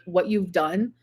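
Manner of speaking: normal talking voice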